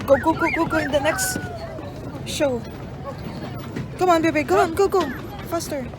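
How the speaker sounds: high, eager hurry-up calls; excited urging. Real, not acted. Hurrying into the next show.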